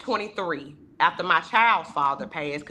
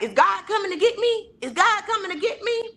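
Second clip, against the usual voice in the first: put-on much higher, pleading old grandmother; grandmother asking; 12 semitones higher than usual. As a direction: ask it high like a frail grandma. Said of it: "She's like".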